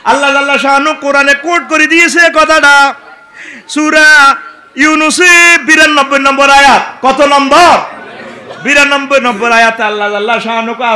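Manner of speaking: loud, high fiery sermon; preaching at full force. Acted. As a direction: drive it at the crowd. Preaching to crowd.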